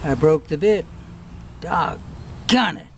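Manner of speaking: loud, exasperated cry; frustrated outburst. Real, not acted. Vlogger exasperated when the drill bit breaks, loud. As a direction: curse the broken bit.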